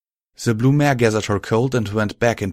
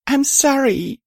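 very high, tearful apology; acted choked-up sorrow; 3 dB louder than usual. A narrator's reading. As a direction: squeeze it out high as if crying. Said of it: "I choked out".

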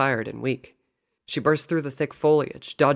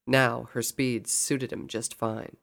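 ordinary delivery, nothing marked

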